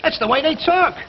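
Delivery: a bigot's mounting sneer. Acted. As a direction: sneer the slur at him. Workers insulting the foreman.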